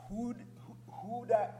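ordinary delivery

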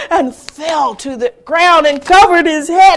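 plain reading voice

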